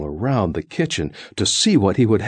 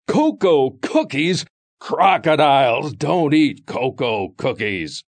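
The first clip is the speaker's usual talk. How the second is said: higher, fiercest storybook crocodile roar; loudest fiercest crocodile; 6 semitones higher than usual. Acted. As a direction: crocodile at his fiercest, refusing cookies. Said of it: "In the loudest and fiercest voice of all".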